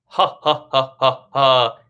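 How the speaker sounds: loud, punched, separate belly-laugh pulses; staged full laugh as a breath exercise; demonstrated belly laugh. Shown as a demonstration. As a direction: push out deliberate ha's from the belly, last one strongest. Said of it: "kind of a deep, full laugh".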